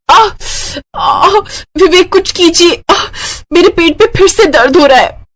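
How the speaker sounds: high acted cries of pain; calling her husband through the pain. Labour pain again. (दर्द से कराहते हुए ऊँची आवाज़)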